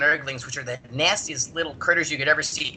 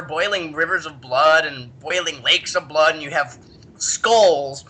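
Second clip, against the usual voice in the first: ordinary delivery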